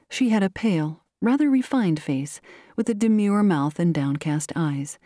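usual speaking voice

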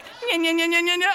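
high, loud nya-nya racket; imitated shrew noise. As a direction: imitate a frantic little shrew at full volume. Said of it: "I hear this".

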